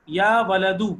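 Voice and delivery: loud model reading for the class; teacher leading choral reading; read aloud for repeating. Shown as a demonstration. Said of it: "میں پڑھوں گا، آپ لوگ میرے پیچھے پڑھیے گا".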